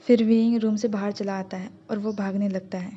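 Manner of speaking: plain reading voice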